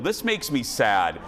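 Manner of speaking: ordinary talk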